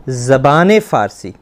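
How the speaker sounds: slow, deliberate zabane farsi; careful izafat demo. Shown as a demonstration. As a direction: spell out ज़बान फ़ारसी as zabaane farsi, linking with an e. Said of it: "तो हम कहेंगे".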